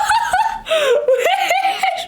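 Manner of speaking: long high squealing laugh; manic laugh. Real, not acted. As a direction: shriek with hysterical laughter. Triumph after frantic kill. (rire hystérique suraigu)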